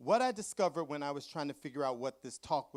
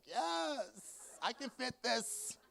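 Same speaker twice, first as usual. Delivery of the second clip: excited; 10 semitones higher, much slower than usual. Retold or imitated. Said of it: "and I was like".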